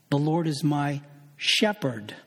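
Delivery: "shepherd" held back by a pause, then stressed. Shown as a demonstration. Preacher finishing the word-by-word exercise, stress on "shepherd". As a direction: pause after "my" and land on "shepherd". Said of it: "emphasize different words in a given verse".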